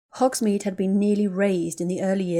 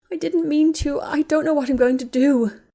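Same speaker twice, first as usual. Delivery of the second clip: higher, louder, breaking sob; rising sob; 8 semitones higher, 6 dB louder than usual. Acted. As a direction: let the crying spill over. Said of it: "Ginny sobbed".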